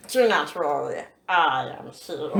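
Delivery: low, slow dragon boom; a hint of a big dragon; deep dragon voice. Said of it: "this is still coming out in that deep dragon voice".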